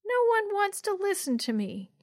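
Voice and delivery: put-on high, pouting little kid voice; very high-pitched childish complaint. A narrator's reading. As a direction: grumble like an ignored small child. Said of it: "Yo-Yo murmured".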